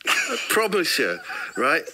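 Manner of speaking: ordinary talk, nothing marked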